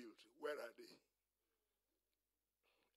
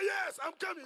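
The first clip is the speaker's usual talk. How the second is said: loud, high eager reply; shouted answer; 11 semitones higher, 9 dB louder than usual. Acted. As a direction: holler back to a friend calling you. Lazarus calls back to Jesus.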